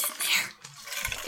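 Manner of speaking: slow eerie whisper; spooky whisper. Acted. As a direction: whisper it like a ghost story. Creepy whisper about wind.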